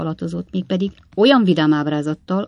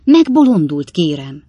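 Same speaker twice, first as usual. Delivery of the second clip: indignant outburst; 8 dB louder than usual. A narrator's reading. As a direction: blurt out in shock at the rascal. Pickwick scolds Bob. (felháborodott kitörés)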